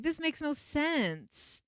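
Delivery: high, drawn-out protest; retold exasperation. Retelling her thoughts on the world's rules, exasperated. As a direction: wail it in bafflement.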